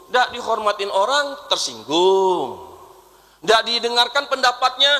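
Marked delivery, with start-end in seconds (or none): 1.5-2.6 slow low sulky "Tersinggung"; mock sulky tersinggung. Mock answer, drawn out.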